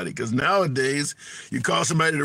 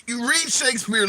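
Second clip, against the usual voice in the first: normal talking voice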